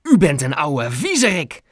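head nurse's indignant telling-off; angry shout. A narrator's reading. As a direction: scold the peeping neighbour forcefully. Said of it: "schreeuwde ze tegen meneer Moejal".

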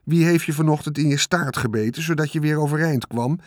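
a rat listing his good deeds smugly. Acted. The rat reminding Wilbur of his deeds, smug sarcasm.

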